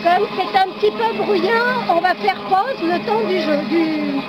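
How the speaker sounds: loud, high-pitched shouting over noise. Real, not acted. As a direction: project hard over a roaring machine.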